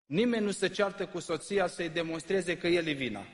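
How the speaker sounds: ordinary talk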